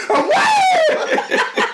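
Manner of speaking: very high squealed shout; mock astonishment breaking into laughter; hammed-up amazement. Teacher reacting to loads of flaps, exaggerated shock.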